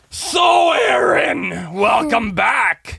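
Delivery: loud, slow sarcastic teacher voice; mock the teacher drawing it out; mocking stern teacher. Stern teacher greeting him back, slow and sarcastic. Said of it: "she goes".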